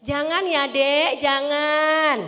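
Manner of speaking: loud, slow, drawn-out catchphrase; hammed-up singsong warning; mimicking viral catchphrase. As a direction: stretch it out like a viral meme. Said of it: "karena viral langsung ya".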